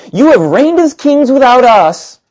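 high, slow, dripping sarcasm; sarcastic. Shown as a demonstration. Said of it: "I think this is the tone".

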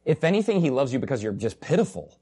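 ordinary talk, nothing marked

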